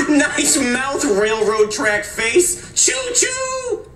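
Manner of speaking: high, laughing jeer; cackle, then drag out the insult into a train hoot; gleeful schoolyard mockery; name-calling taunt. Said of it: "get ready for some more name calling".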